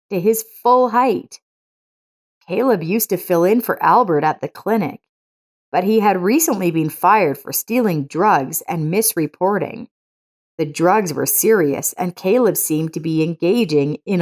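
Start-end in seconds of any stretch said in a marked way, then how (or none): none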